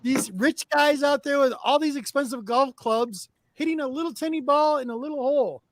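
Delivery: animated rant. Real, not acted. Mocking rich golfers.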